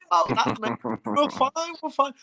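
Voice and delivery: put-on high, dopey, carefree drunk voice; drunk mate voice. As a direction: wave it off like a tipsy friend. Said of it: "He's gone".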